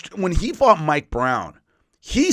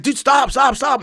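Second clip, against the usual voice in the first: high, loud urgent yell to stop; panicked referee shouting stop; 11 semitones higher, 5 dB louder than usual. Retold or imitated. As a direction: shout him off the hold like a frantic referee. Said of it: "And the referee's like".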